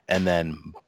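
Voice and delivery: ordinary talk, nothing marked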